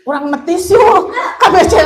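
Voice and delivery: playful laughing ask; laughing invite. Retold or imitated. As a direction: giggle out the friend's offer.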